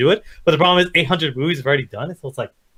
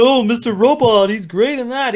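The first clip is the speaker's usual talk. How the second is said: mock gushing praise; 7 semitones higher, 4 dB louder, slower than usual. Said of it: "'Cause they were like".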